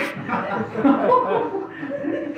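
loud, high mocking laugh; hearty villain laugh. Rukmi mocks his sister, a loud scornful laugh.

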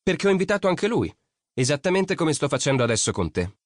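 molto più alta, pungente; ragazzina provocatoria. A narrator's reading. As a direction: stuzzicalo con voce alta. Nina provoca Lori. (Nina provoca Lori, voce alta)

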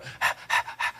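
quick puffs of air, no voice, like you're winded; faked panting. Shown as a demonstration. Actor shows the cheap way to sound winded, fake panting. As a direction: huff out short breaths to fake being out of breath. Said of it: "you could just go".

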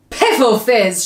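loud giant's shout; booming giant outburst. A narrator's reading. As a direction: shout it dismissively as a storybook giant. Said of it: "shouted the Blood Bottler".

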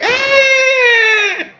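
long high falsetto squeal; drawn-out mock shriek; imitated scream. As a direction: squeal piercingly as if scared to death. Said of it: "it screamed and it scared her".

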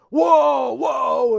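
high, loud mock shout; angry shouted woe. Shown as a demonstration. Showing the wrong angry way to say woe. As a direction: bark the word out in anger. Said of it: "So you're not gonna be going".

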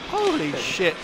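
ordinary talk

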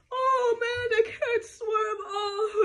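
loud, high hammed-up cry; mock sobbing villain sinking; wailing Pharaoh. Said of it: "Pharaoh goes".

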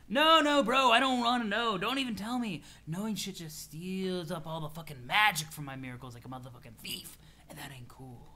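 laid-back troll, protesting. Acted. Troll tells friend knowledge steals miracles, fading mellow protest.

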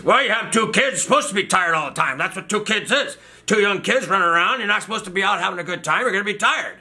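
high-pitched, indignant mock scolding; imitated yelling doctor. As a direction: rant like a fed-up old doctor. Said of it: "So he just starts yelling at me".

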